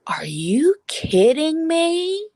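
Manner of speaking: high, slow, indignant woman's voice; ghost woman's indignant voice. Retold or imitated. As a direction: play the offended ghost woman. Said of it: "a woman's voice going".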